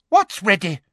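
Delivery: high, loud demand; insistent interrogator. A narrator's reading. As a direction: snap the question at her. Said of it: "demanded Umbridge".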